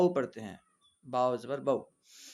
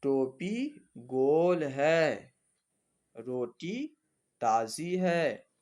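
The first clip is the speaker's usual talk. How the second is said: slow, word-by-word dictation read aloud; much slower than usual. Acted. Teacher dictating practice words to students, slow and deliberate. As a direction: spell it out slowly for the class to follow.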